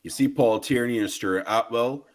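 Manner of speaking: usual speaking voice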